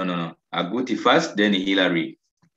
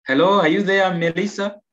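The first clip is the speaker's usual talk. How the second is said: loud, high call to a missing pupil; 7 semitones higher, 4 dB louder than usual. Real, not acted. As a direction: call out to check someone is there. Calling on an unresponsive pupil.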